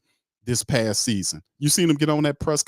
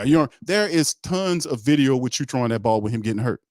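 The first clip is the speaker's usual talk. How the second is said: normal talking voice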